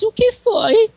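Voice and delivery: high, loud hurt outburst; offended "o que foi?". Retold or imitated. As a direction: yelp it out, feeling slighted. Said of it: "que você levantou a voz".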